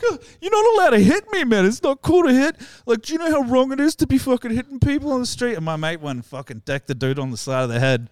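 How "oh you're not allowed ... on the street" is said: much higher, pleading whine; mock whiny victim. Mocking the drink thief who begs not to be hit. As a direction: squeak it out like a preachy wimp.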